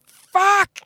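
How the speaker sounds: high-pitched re-enacted scream of rage; high, strained mock scream; retold scream. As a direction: act out a sudden scream at the other driver. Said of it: "And I scream".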